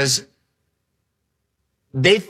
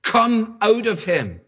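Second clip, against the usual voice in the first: stern command; 6 semitones higher, slower than usual. Acted. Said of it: "said Jesus sternly".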